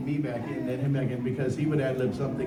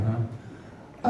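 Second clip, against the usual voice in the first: ordinary delivery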